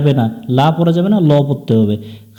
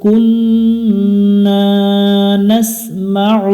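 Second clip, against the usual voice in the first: melodic Quran recitation; 3 semitones higher, much slower than usual. Shown as a demonstration. Teacher chanting verses slowly for students to follow. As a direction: stretch every vowel in a lilting recitation tune.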